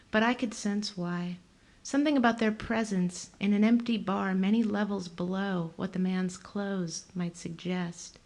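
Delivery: plain reading voice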